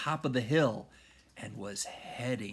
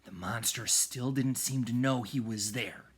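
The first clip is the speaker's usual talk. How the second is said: ordinary delivery, nothing marked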